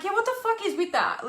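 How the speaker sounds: high, incredulous retort. Retold or imitated. Answering a webinar lady, she fires back incredulously. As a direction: toss it back in disbelief. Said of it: "And I'm like".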